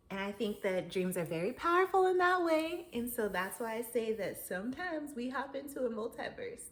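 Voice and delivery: ordinary talk, nothing marked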